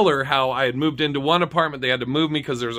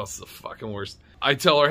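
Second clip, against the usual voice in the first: plain reading voice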